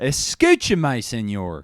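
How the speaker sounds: loud, high mock Spanish-speaking dad; hammed-up Spanish. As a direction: shout it in overdone tourist Spanish. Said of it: "That's my dad".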